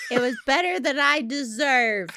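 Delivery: loud, high-pitched quoted exclamation; imitated delivery guy. Guest quotes the UPS guy on Christmas, loud and high. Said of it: "That's what the U P S guy said".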